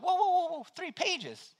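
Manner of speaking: high incredulous whoa; mock shock. Pastor retelling the insurance call, mock disbelief. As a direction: act floored by the paperwork. Shocked at three-page paperwork.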